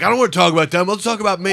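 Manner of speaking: loud, high self-centered whine; mock vain self. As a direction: play your younger self, all ego. Mocking his younger self-centred self.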